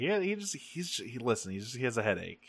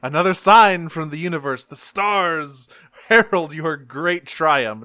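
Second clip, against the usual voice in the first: grandiose oracle; 4 semitones higher, 7 dB louder than usual. Retold or imitated. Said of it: "now it's like".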